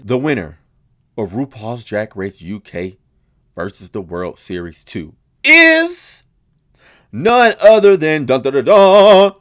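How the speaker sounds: slow dramatic winner reveal with drumroll. Retold or imitated. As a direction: build suspense then hit the name.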